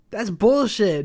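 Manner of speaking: high, loud furious protest; outraged yell. Real, not acted. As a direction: yell it at the screen. Outraged at nothing happening.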